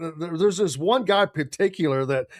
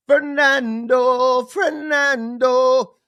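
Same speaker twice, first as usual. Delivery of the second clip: very slow, sung-out Fernando, a bit louder and higher; drawn-out name; 6 semitones higher, 5 dB louder, much slower than usual. Pretend. Naming the quarterback in the latest piece with a slow drawn-out call. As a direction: stretch each syllable of Fernando like a chant.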